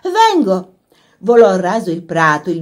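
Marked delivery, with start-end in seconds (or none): 0.0-0.7 high, loud answering shout; calling back. A narrator's reading. Laura answering the voice from the house, calling out. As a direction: shout back that you're on your way.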